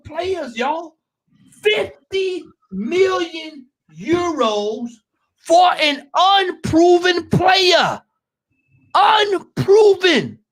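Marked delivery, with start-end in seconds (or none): 1.6-10.4 slow outraged shout. Real, not acted. Fan ranting to the panel about the fee, slow outraged shouting. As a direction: drag out "Fifty million euros" and shout it slowly.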